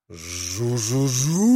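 slow buzz sliding upward; imitated plane buzz. A narrator's reading. Boy playing at being a plane, rising buzz. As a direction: buzz like a propeller revving up. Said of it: "и кричал он".

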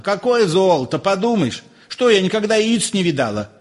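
high-pitched scoffing wife; scolding wife. A narrator's reading. Wife scoffs at husband's fuss, high and dismissive.

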